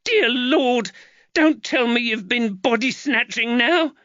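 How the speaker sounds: mother horrified at her son; scolding mother. Acted. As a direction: scold the boy in a high voice. Giacchetta scolding.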